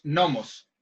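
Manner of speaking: "gnomos" said as "nomos", slowly; silent G. Shown as a demonstration. Host correcting his friend on the word, silent G. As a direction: drop the G in "gnomos", starting on n. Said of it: "la G es muda".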